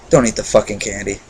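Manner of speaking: low, quiet deadpan warning; lightly acted, softly spoken tough-guy warning; imitated Hansel. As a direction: drop the voice for his dry movie line. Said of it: "a great line delivered from him".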